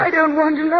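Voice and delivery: begging her to stop, close to panic; distraught plea. Acted. Wife refusing to hear of the first wife, distraught.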